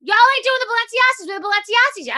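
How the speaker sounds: hammed-up angry rant. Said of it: "she gets really mad and she says".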